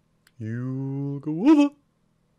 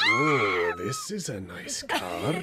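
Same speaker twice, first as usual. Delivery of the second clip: much lower, gruff admiring tone; low creature voice; 9 semitones lower, 6 dB quieter, slower than usual. Acted. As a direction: rumble it like a big beast.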